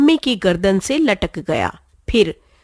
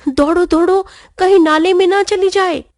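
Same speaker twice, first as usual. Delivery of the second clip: high, urgent panicked call; 8 semitones higher, faster than usual. A narrator's reading. Pappu panics as his ball rolls toward the drain. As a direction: hurry them before the ball is lost. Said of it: "घबराहट भरे स्वर में".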